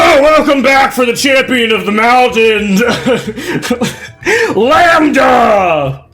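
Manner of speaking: high, loud arena announcer shout. Acted. Scary Joe brings out the mountain champion, big announcer voice. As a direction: bellow the champion's entrance to the crowd. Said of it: "put on my announcer voice".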